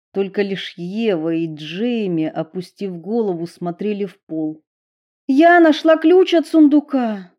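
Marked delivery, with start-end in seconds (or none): 5.3-7.3 high loud excited cry; excited exclamation. A narrator's reading. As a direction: announce the find with joy. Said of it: "воскликнула Эмили".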